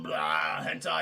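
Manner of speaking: very low monster voice; creature growl. Retold or imitated. Creature heard behind the door, low monster growl. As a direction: growl it deep like a beast. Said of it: "he hears that creature going".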